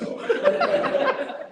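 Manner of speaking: laughing. Real, not acted.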